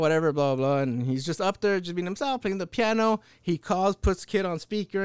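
normal talking voice

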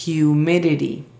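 "Humidity" with both D and T softened to light taps; soft D and T. Shown as a demonstration. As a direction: say "Humidity" as hyu-MI-di-di, consonants soft. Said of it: "la D y la T, son con sonido suave".

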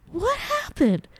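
very high, bewildered and helpless; baffled squeak. Retold or imitated. Mocking herself to partner, high baffled squeak. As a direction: squeak in total confusion. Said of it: "I'm like".

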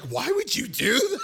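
high, laughing, half-shouted disbelief; incredulous. Retold or imitated. Producer being asked why, with laughing disbelief. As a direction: yelp it out through a laugh.

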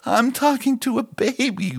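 put-on high sing-song voice for a baby; baby-talk voice. Shown as a demonstration. Devotional host demonstrating the voice people use with babies, high baby talk. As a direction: coo it like talking to an infant. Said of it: "switching to the ... voice".

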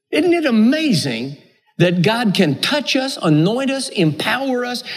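ordinary delivery

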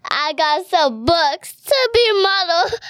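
giggling through the words; laughing. Real, not acted. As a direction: laugh as you say it. Laughing while retelling dad's joke.

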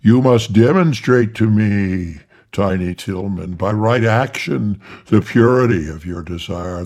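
loud, booming archangel voice; thundering archangel. Acted. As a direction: thunder it from above. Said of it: "still thundering in the voice of the archangel".

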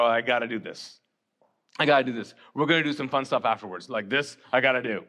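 reluctant guest. Retold or imitated. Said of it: "Why do you think you're here?".

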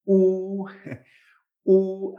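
U as one long pure vowel; doble U. Shown as a demonstration. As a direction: hold U long, no consonant before it. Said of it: "simplemente dirías dos U's". (U larga, como dos úes seguidas)

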